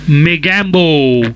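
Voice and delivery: slow booming villain yell; drawn-out loud villain call; Mogambo shout. Shown as a demonstration. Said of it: "मैं ये भी लेना चाहता था".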